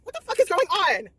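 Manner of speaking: loud, high-pitched disbelief; head spinning at the twist; shocked outburst. Retold or imitated. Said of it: "I'm like".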